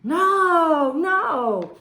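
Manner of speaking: high, drawn-out denial; audience's answer. Acted. Readers answering the bus driver, high innocent denial. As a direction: call it out high and loud, then ease down. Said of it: "We say".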